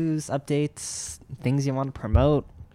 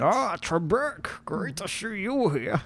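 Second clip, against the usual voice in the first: high, slow Connery-style Bane voice; drawn-out movie villain impression; Sean Connery Bane; 8 semitones higher, slower than usual. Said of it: "That was more like Bane".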